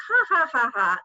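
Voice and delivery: a chorus of forced chuckles; fake laugh. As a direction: act out the group's dismissive laugh. Mimicking coworkers' polite laughter.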